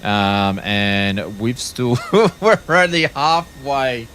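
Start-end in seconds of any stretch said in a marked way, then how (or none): 2.0-4.1 laughing in disbelief. Real, not acted. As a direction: chuckle through the words. Laughs at long wait.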